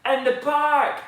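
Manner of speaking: loud, high shout of alarm; the boy bellows his warning; top of his voice. Acted. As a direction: yell it as loud as a boy can. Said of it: "at the top of his voice".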